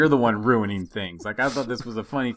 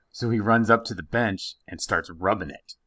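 ordinary delivery

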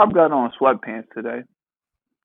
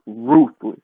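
ordinary talk, nothing marked

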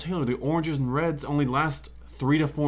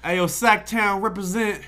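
hype shout-out; 6 semitones higher, 4 dB louder, slower than usual. Acted.

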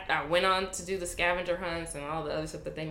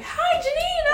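very high, loud, laughing hi; excited greeting; 7 dB louder, slower than usual. Retold or imitated. Crowd at the bar greeting her, very high and excited. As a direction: sing out a welcome. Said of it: "And they were like".

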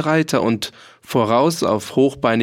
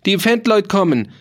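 shrieked alarm; 8 semitones higher than usual. A narrator's reading. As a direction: screech it out as a frightened crowd. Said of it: "Da kreischten viele Leute".

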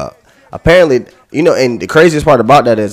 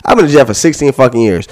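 ordinary talk

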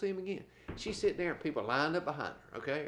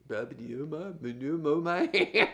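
mock stutter; 3 semitones higher, 5 dB louder, slower than usual. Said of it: "now he's really stuttering".

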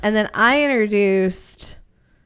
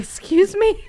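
shocked; 13 semitones higher than usual. Retold or imitated. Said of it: "It's like".

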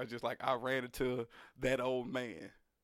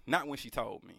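usual speaking voice

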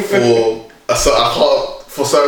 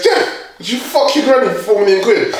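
high-pitched mock questioning, amused; imitated colleague; 8 semitones higher than usual. Guy putting the question to Jeff, imitated with laughter. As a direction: pitch it up as an astonished workmate. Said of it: "and he goes".

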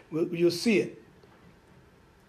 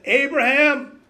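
loud calling of the name, higher and stronger; 4 semitones higher, 5 dB louder, slower than usual. Acted. God calling Abraham from heaven, loud ringing call.

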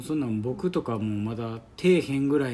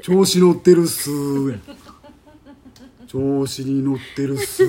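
loud, cocky put-on voice; cocky character voice; 9 dB louder than usual. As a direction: swagger through it like a show-off. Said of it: "誰それ？".

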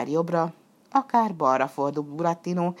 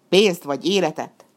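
robber demand; 7 dB louder than usual. A narrator's reading. Robbers ambush demanding money.

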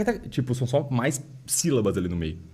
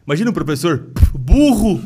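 mock dumb teacher; 4 semitones higher, 9 dB louder than usual.

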